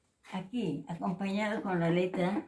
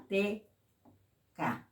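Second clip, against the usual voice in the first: normal talking voice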